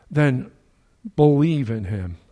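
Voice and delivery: ordinary delivery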